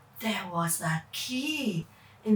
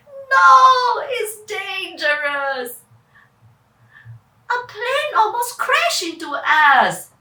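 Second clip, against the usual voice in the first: louder, higher, urgent; alarmed sister; 4 semitones higher, 7 dB louder than usual. Acted. Sister urges brother home after near crash, alarmed. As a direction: plead to go home. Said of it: "Charlene replied".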